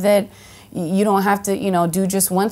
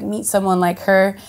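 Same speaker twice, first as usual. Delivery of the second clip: usual speaking voice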